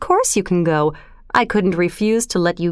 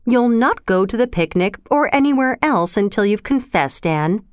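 ordinary delivery

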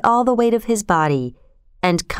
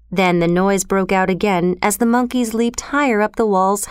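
plain reading voice

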